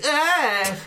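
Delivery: sudden high squeal like a squeezed toy; the sock's battery voice squeals when squeezed; high squeaky toy shriek. Retold or imitated. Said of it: "it goes like".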